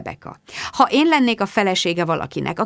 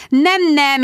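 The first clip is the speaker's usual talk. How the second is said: brushing off a warning; 8 semitones higher, 5 dB louder than usual. A narrator's reading. Rebecca brushes off Mark's warning.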